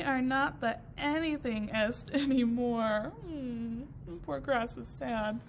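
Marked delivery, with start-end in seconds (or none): none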